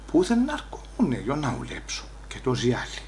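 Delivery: inner voice. Retold or imitated. He thinks to himself in quiet complaint. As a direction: say it to yourself, under your breath. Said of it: "λέει, από μέσα μου".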